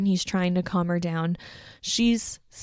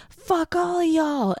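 much higher and slow, imitated outburst; mock furious yell; 10 semitones higher than usual. Candace cursing everyone before throwing a can, mock fury. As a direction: hurl it at the whole room.

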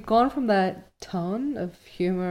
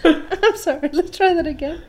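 high-pitched, still giggling; laughing apology; 10 semitones higher, 4 dB louder than usual. Real, not acted. Reader restarting the line after cracking up, laughing. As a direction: apologise through real laughter.

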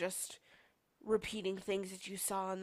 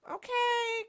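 oblivious mom; 5 dB louder than usual. Retold or imitated.

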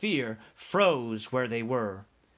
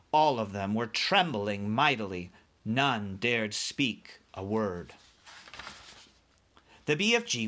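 ordinary delivery

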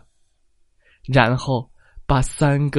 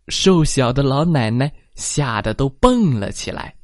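plain reading voice

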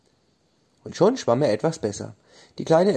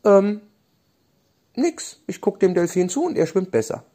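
high, startled innocent; caught out; 9 semitones higher, 3 dB louder than usual. Acted. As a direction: pretend nothing happened, pitched way up. Leonie caught, playing innocent.

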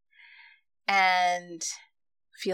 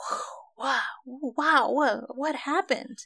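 amazed; 7 semitones higher than usual. Retold or imitated. Amazed by first breath work.

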